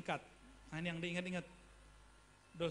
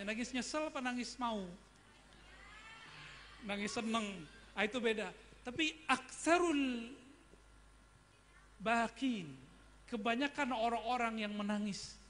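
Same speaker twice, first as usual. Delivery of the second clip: normal talking voice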